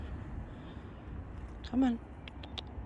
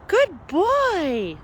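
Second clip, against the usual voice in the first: pet praise; 6 semitones higher than usual. Real, not acted.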